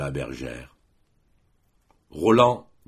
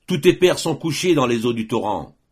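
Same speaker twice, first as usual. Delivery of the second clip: loud, raised taunting cry; shouted taunt; 6 semitones higher, 6 dB louder than usual. A narrator's reading. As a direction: shout it at the enemy, high and loud. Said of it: "criait le mort". (provocation criée d'une voix forte)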